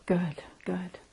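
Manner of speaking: soft whisper of praise; hushed approval after the phrases; whispered encouragement. Real, not acted. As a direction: whisper it gently.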